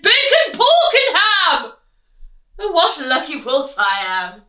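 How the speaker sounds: loud, high gleeful wolf shout; triumphant wolf yell. Acted. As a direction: bellow it out like a greedy wolf. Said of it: "He shouted".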